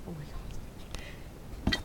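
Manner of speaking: slow hushed whisper; slow soft whisper. Real, not acted.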